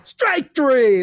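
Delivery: loud, high stadium call; umpire's strikeout call. Acted. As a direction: belt out the strikeout. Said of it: "if the guy struck out".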